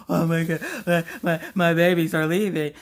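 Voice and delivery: loud mock sobbing mama; exaggerated bawling, words falling apart; blubbering. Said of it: "sitting there blubbering and".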